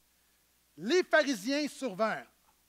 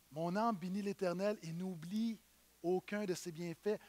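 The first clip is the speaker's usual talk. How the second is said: soft reverent recital; 4 semitones lower, 8 dB quieter than usual. Acted. Pastor reciting a psalm to the congregation, hushed and reverent. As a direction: breathe the psalm out gently like a prayer.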